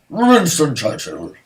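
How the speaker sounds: drawn-out, growled mock statesman; hammed-up Winston Churchill. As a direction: roll out the name like a wartime orator. Hammed-up answer, then repeated plainly.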